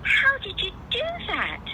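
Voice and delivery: put-on high girlish wonder; child's amazed question. Lucy asks Aslan in high amazement. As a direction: ask it wide-eyed like a little girl.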